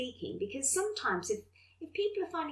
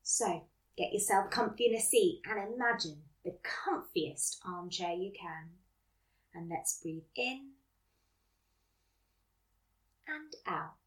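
guided meditation; 3 semitones lower, 5 dB quieter than usual. Acted. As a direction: gently guide them to relax. Leads mindfulness exercise.

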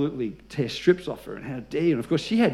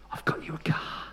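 whispered secret; 10 dB quieter than usual. Retold or imitated. Clerk telling the pastor, whispered secret. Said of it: "She says".

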